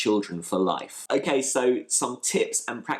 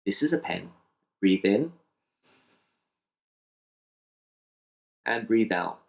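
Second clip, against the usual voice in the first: calm guided demo. Shown as a demonstration. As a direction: model the exercise gently, pausing between phrases. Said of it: "I'm just going to say".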